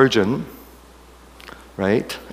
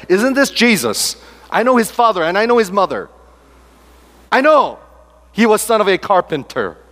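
grumbling crowd; 8 semitones higher than usual. Pretend. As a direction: play the scoffing crowd.

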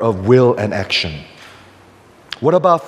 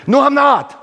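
higher-pitched, panicky quoted denial; scared denial; 11 semitones higher than usual. Retold or imitated. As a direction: blurt it out like frightened Peter. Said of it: "he gets so scared".

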